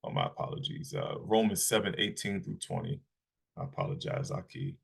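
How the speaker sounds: quiet, low-pitched apology; soft, low aside to the listeners; apologetic aside. Real, not acted. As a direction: drop your voice to excuse yourself. Apologetic aside after misreading verse.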